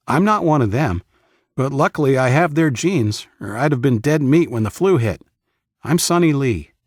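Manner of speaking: cheerful voice for a friendly leader. Acted.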